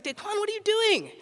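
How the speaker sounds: high, startled call of his name; startled cry. Retold or imitated. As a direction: jolt awake and call out high in surprise. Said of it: "I woke up with this start".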